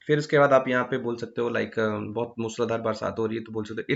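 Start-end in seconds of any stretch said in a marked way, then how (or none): none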